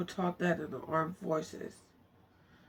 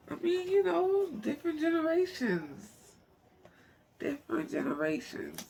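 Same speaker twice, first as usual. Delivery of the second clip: higher voice, lightly mimicking an elder; mock excuse; 11 semitones higher than usual. Viewer mocking old folks' excuse in higher mock voice. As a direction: shrug it off in a high put-on voice.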